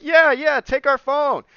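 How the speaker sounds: high, loud mock-helpful strangers; eager offer. Strangers lending a lost traveler their phone, eager offer. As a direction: push the help on him, high and loud.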